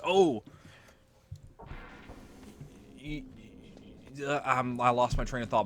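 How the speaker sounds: flustered, stammering Miller; Miller impression. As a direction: fumble the words like Miller. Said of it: "that's normally what Miller will say".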